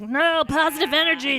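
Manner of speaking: high, loud mock cry; mock villain protest. Host as Vigo thwarted by good feeling, mock yelling. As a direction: wail it like a thwarted movie villain. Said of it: "he's like".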